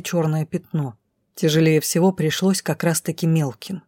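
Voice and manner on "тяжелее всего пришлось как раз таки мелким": usual speaking voice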